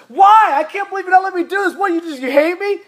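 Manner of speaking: high, shouted, fast outburst; teen tantrum. Acted. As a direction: yell like a furious teenager.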